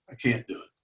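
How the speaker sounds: hushed, subdued admission; soft retold reply, well under normal voice; real quiet. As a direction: drop right down, as a father giving in. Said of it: "My father got real, real quiet".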